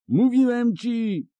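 high, slow triumphant roar. A narrator's reading. Bonhart roars at Ciri, spurring his horse. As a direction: draw it out like a gloating hunter. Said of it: "zaryczał Bonard".

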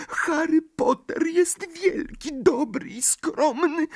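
very high, awed elf voice; a tiny servant in adoration; admiring house-elf. A narrator's reading. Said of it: "rzekł z podziwem Zgredek". (zachwycony skrzat)

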